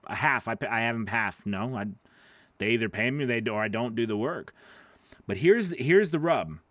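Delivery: ordinary talk, nothing marked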